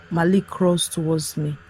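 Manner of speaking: usual speaking voice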